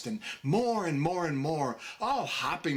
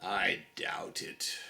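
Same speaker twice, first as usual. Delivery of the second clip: much lower, slow and doubtful; gloomy carpenter; 9 semitones lower, 4 dB quieter, slower than usual. A narrator's reading. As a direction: drop deep and drag it out like a glum carpenter. Carpenter answers the walrus.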